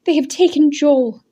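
high, loud and urgent; distressed. A narrator's reading. Thacia telling Daniel of Joel's arrest, distressed. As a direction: blurt out the bad news. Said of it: "she gasped".

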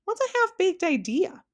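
very high, inquisitive question; puzzled question. A narrator's reading. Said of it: "asked Milo again".

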